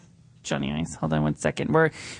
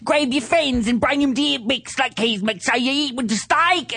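singsong, overdone Aussie advert voice; exaggerated Australian accent; 4 semitones higher than usual. Host mimics steakhouse commercials, exaggerated singsong Australian accent. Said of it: "they exaggerate the accent".

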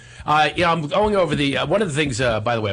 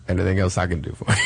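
Morgan Freeman voice; 6 semitones lower than usual. Pretend. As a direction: lower calm narrator voice, lightly done. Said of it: "it should be Morgan Freeman".

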